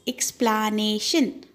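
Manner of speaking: EXPLANATION slowly split, ex-pla-na-tion; syllable by syllable. Shown as a demonstration. Teacher showing children four syllables, EXPLANATION slowly broken up. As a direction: stretch out each of the four beats. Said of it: "we have to split it".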